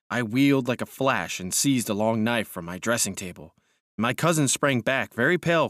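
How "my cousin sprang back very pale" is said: plain reading voice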